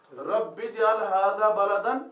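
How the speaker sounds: slow, emphatic Quranic reading; slow recitation. Shown as a demonstration. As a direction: recite the prayer slowly and clearly. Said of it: "काला इब्राहिमु".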